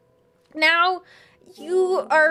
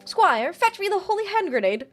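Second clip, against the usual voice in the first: lightly put-on British accent, emphatic count; 5 semitones higher than usual. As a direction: declaim it with a touch of British accent. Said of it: "I said threeser in a British accent".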